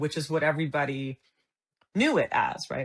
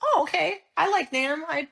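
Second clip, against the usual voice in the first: eager excitement; 8 semitones higher than usual. Retold or imitated. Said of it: "And I went".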